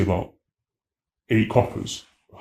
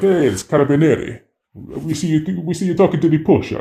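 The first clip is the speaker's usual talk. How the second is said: higher, louder stern police officer calling out; mock Italian cop; 8 semitones higher, 5 dB louder than usual. Italian police officer confronting a tourist, loud and stern. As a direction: play the carabiniere catching him out. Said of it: "were like".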